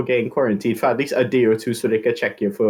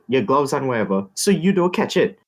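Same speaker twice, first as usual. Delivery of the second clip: usual speaking voice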